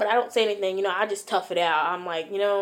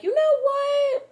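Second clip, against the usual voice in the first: very high, drawn-out mock retort; exaggerated high-pitched exasperation; fed-up comeback; 4 dB louder, slower than usual. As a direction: sing it out way up high, slowly. Fed-up reply to boy's excuse.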